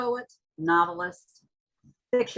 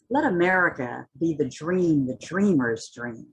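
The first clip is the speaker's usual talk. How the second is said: rising, impassioned spoken-word reading; dramatic poetry reading with swelling intensity; poem recitation; 4 semitones higher than usual. Acted. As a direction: recite it like a rallying cry, building toward the end. Recites Hughes poem after introduction.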